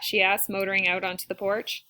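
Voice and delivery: ordinary delivery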